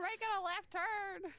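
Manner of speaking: high, slow joke driver voice; mock racing driver. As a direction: draw it out high-pitched, playing driver.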